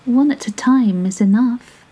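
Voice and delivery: a small clever fish flattering the whale; small 'stute voice. A narrator's reading. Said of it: "said the 'stute fish".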